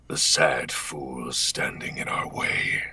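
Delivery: slow, low whispered menace; Omen voice line. Acted. Reciting his favourite line to the streamer, low whisper. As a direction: whisper it deep and slow like a phantom. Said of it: "I always liked the".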